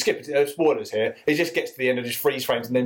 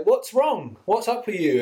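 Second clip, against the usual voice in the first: bad movie dubbing; 7 semitones higher, slower than usual. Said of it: "talking to a dog like a person".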